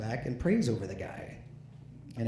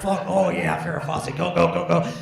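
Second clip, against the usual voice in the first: higher, louder, laughing hammed-up cheering; mock excited teen; 6 semitones higher, 6 dB louder than usual. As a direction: ham up a boy urging himself on. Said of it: "I'm like".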